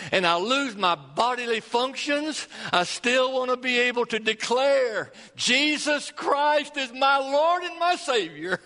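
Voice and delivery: rising, fervent preacher's declaration; preaching. Acted. Pastor rising into full preaching.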